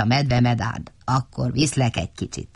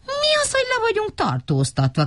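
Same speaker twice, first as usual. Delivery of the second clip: very high astonished question; surprised squeak. A narrator's reading. Little Matti squeaking at the arrest, astonished. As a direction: squeak it out in disbelief.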